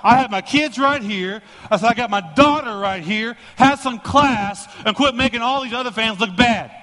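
furious dad telling off the heckler; angry confrontation. Retold or imitated. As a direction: warn the heckler to shut it, hard. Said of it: "looked at that guy and said".

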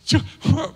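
short high chuckle at God; Abraham's laugh. Acted. Old Abraham laughing at God's promise, a short chuckle. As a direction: laugh it off, incredulous. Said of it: "And he starts laughing".

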